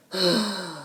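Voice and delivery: low weary sigh; reluctant sigh. Acted. Max sighs before apologizing. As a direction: give in grudgingly. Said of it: "Max sighed".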